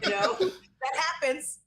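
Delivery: words through a laugh. Real, not acted. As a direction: laugh while saying it. Laughing after joke.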